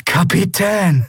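high, slow, drawn-out exclamation; overjoyed relief. A narrator's reading. Miko hearing his captain alive, high relieved cry. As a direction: breathe out the title in huge relief.

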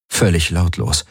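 ordinary delivery

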